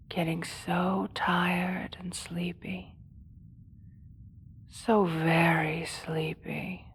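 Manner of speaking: slow soft whisper lulling to sleep; sleepy hypnotic whisper. Acted. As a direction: coax them to sleep, slowly. Putting guard to sleep.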